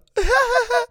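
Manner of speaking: high squealing cackle; imitated laugh. Podcaster copying the old dude's high laugh. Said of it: "and then fucking laughed about it".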